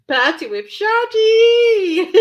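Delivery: long, high, loud drawn-out wail; silly drawn-out yell. Pretend. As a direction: stretch it out in a silly high howl. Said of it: "making those noises".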